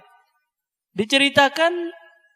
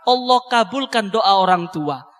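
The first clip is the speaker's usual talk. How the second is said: ordinary talk, nothing marked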